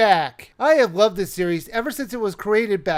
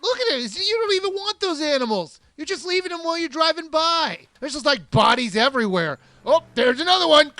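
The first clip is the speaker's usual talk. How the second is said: incredulous rant; 6 semitones higher than usual. Acted. Mocking the jeep hunters.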